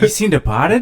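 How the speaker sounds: loud, high Bill Burr-style Boston voice; Boston accent. Pretend. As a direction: play an angry Boston cop. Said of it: "exactly who I was imagining".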